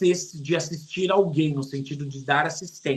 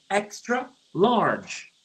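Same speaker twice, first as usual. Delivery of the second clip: "extra large" said very slowly and clearly; teacher drawing out "extra large"; slow spelling out; 2 semitones higher, much slower than usual. Shown as a demonstration. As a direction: unpack the letters slowly for students. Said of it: "ela é X L, ou seja".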